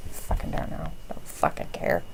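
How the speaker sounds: breathy whispered brush-off; whispered shrug. Real, not acted. Watching wrestling with a friend, whispering a dismissive shrug. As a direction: toss it away under your breath.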